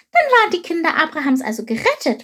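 high, quick, delighted voice; joyful. A narrator's reading. Chilp learns the people are saved, joyful. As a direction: rejoice in a high little voice. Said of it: "freute sich Chilp".

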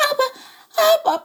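high, piping little bird voice; squeaky sparrow. Acted. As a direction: pipe it out like a tiny sparrow. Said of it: "piepste Chilp".

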